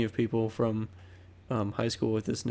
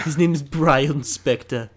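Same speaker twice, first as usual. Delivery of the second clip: slow, louder cartoon voice; Stewie Griffin; 3 semitones higher, 5 dB louder than usual. Friend names the uncle in his cartoon impression. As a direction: stretch it out in a cartoon voice. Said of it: "trying to perfect my Stewie Griffin voice".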